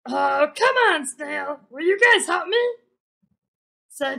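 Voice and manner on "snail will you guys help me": usual speaking voice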